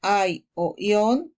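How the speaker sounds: ai o ion spelled out slowly; slow and distinct on ai and ion; endings said out. Shown as a demonstration. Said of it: "Los sustantivos que terminan en".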